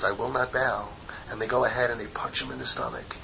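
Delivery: low, soft, suspenseful telling; hushed storytelling. Retold or imitated. Teacher tells students of a night ambush, hushed suspense. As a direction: drop to a near whisper, with long pauses.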